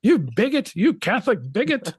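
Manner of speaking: high, loud hammed-up outraged shout; mock outrage. A parent mock-shouting back at a concerned cousin, in hammed-up outrage. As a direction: throw a theatrical accusation. Mock accusation in retold argument.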